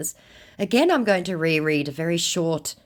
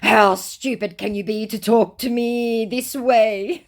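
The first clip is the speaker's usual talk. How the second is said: slow, higher-pitched indignation; mock mortally offended; 6 semitones higher, 4 dB louder than usual. Scammer rebukes her insult, theatrically offended. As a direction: drag it out like a wounded diva. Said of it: "said our mortally offended scammer".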